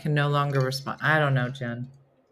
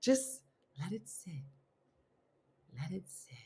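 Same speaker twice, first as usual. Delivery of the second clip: hushed calming; 16 dB quieter than usual. Retold or imitated. Telling Steph to leave the password alone, very softly. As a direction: ease her down gently, barely voiced.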